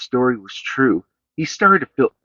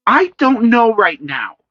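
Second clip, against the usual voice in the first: high, louder, cutting mother; sharp; 12 semitones higher, 5 dB louder than usual. A narrator's reading. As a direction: snap back at her son. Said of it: "said Senny sharply".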